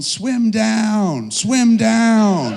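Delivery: high, loud drawn-out cry; big high-pitched shout, stretched out; yelling. Retold or imitated. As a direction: imitate a kid yelling over a crowd. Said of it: "She started yelling".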